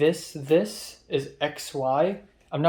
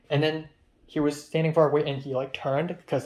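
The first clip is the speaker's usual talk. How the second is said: ordinary delivery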